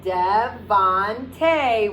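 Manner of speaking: slow "Devontae" split into three beats; syllables spaced out. Shown as a demonstration. Teacher clapping out the syllables of a name for the kids. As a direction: say "Devontae" one beat per syllable. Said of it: "We're gonna jump three times".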